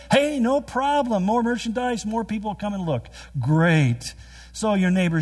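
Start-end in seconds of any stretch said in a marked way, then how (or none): 0.1-3.1 higher, upbeat, happy to help; eager host. Retold or imitated. You welcoming the neighbor's junk, bright and high. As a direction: sound delighted at more stuff to sell.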